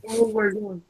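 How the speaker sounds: talking through a yawn. Real, not acted. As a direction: say it mid-yawn. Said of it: "I'm yawning too much".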